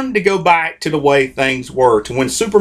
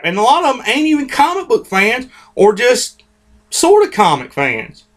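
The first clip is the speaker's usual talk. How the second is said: usual speaking voice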